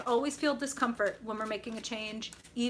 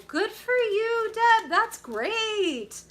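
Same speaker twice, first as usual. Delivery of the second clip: loud, high, drawn-out praise; elated cheering; 11 semitones higher, 8 dB louder, much slower than usual. Real, not acted. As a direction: cheer a friend on at full tilt. Cheering on a viewer.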